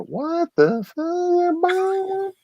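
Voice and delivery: slow amazed disbelief in a strained voice; stunned outburst. Retold or imitated. Finding a rare cask strength bottle, blurted in stunned amazement. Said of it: "I go".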